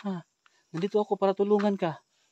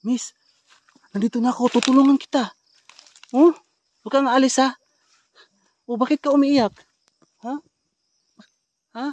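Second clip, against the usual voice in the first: calling out; 7 semitones higher, 11 dB louder than usual. Real, not acted. Calling to the woman.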